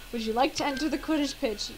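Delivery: ordinary talk, nothing marked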